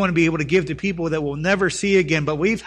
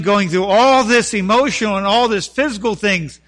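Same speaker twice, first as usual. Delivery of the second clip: loud, raised sermon voice; fervent preaching; 6 semitones higher, 9 dB louder than usual. Acted. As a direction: build the fervor phrase by phrase. Preaches at full force.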